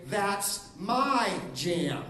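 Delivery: slow, drawn out claim; slow protest over breakfast; slow, possessive that's my jam. Shown as a demonstration. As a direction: claim it slowly like your food. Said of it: "breakfast example".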